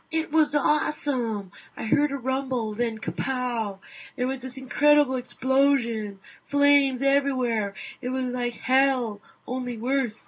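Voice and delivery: oversell it like a thrilled eyewitness; brash American gushing over the explosion; excited American witness. Acted.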